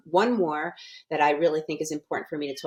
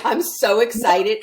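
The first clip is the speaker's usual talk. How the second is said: excited; 5 semitones higher, 7 dB louder than usual. Real, not acted. Repeats her excitement.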